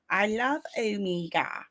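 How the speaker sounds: drawn-out, rough attempt at a New Zealand accent; slow, hammy Kiwi accent, not quite nailed. Host reading fan's love for Omega, shaky Kiwi accent. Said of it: "That is not my best Kiwi accent".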